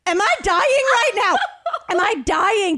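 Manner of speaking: high, loud mock panic. Stomach noise scares her, high mock alarm. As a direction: yelp it in hammed-up alarm. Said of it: "I was like".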